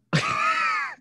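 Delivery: high, squealing laugh; burst of laughter. Real, not acted. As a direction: crack up at the joke. Laughing after skit line.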